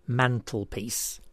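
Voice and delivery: "mantelpiece" slowly, in separate parts; syllable by syllable. Shown as a demonstration. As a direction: draw out each part of "mantelpiece". Puzzle answer shown by syllables.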